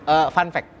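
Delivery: ordinary talk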